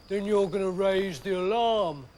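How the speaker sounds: lightly voiced Samson, knowing; Samson, big lumbering hero. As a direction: play the strongman, a touch smug.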